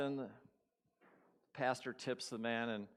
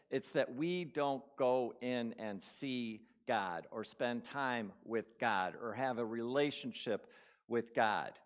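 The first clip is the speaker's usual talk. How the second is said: ordinary talk, nothing marked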